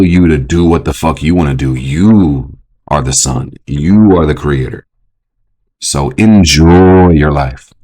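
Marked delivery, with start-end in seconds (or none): 1.7-7.7 slow empowering. Acted.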